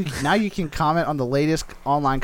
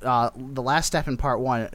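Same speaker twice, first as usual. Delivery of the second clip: usual speaking voice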